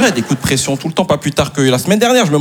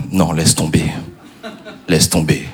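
sulking wife; 10 semitones lower, slower than usual. Retold or imitated. Wife sulks, drops it.

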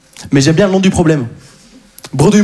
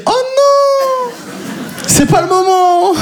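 much higher, slow panicked whine; panicked inner voice; much slower than usual. Retold or imitated. High panicked thought as police speak behind him. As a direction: panic inside your head. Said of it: "je me dis".

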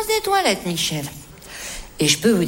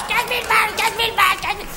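mimicking hysteria; 7 semitones higher, faster than usual. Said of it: "sur un mode complètement hystérique".

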